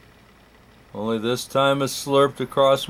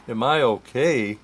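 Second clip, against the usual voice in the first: plain reading voice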